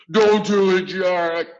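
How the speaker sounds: high, very loud screech. A narrator's reading. Giant screeching at Jack in his sleep. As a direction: shriek it out. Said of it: "he screeched".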